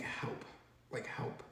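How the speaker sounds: barely audible plea for help; whisper-quiet help, almost swallowed; very quiet call for help. Retold or imitated. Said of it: "But I'm saying it so quiet".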